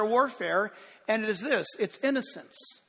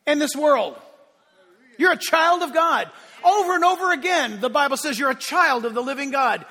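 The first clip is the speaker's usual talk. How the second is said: normal talking voice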